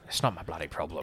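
grumbled under the breath, in character; muttering to oneself. Acted. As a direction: mutter it to yourself. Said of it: "say that to myself out loud".

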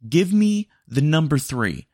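loud, slow, fed-up customer; frustrated order shout. Acted. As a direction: bark the order at the speaker. Customer yells order at bot.

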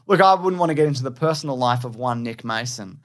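ordinary delivery

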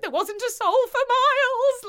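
very high, spooky mum. Retold or imitated. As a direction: pitch it way up. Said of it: "Mum's like".

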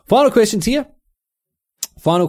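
high, loud segment announcement; announcer call. Real, not acted. Introducing final listener questions, high and loud. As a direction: herald the last segment.

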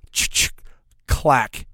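imitated pump-action clack; mouth-made gun cocking, crisp clicks; shotgun rack noise. Answering the protesting shopper, imitated shotgun racking.

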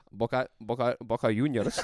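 mock Spanish accent, laughing; hammed-up Spanish accent on Boca Juniors. Shown as a demonstration. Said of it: "do all those in a Spanish accent".

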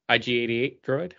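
usual speaking voice

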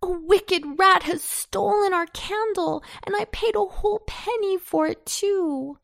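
high-pitched indignant lament; acted outcry over a loss; dismayed cry. A narrator's reading. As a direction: complain high, as a wronged boy. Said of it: "cried he".

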